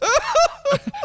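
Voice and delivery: squealing high-pitched laugh; high burst of laughter. Real, not acted. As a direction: break into a shrill laugh. Laughing at own story.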